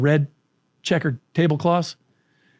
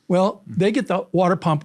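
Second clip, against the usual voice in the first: ordinary talk, nothing marked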